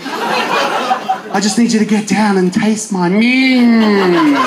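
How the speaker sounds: slow seductive lady voice; sultry lady. Pretend. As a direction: purr it out slowly as an attractive woman. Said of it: "can never get away with the word minge".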